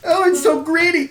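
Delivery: high whiny complaint; whiny kid. Acted. The dazed kid complains while licking the lid. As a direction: grumble through a mouthful of ice cream. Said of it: "starts licking it like a dog".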